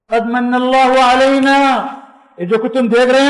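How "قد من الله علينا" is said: recitation. Acted. Quranic phrase recited.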